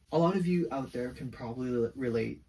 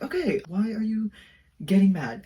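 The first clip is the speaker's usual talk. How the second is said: indignant retort; 6 semitones higher, 7 dB louder than usual. Retold or imitated. Retold argument with parent.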